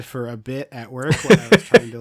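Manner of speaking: ordinary talk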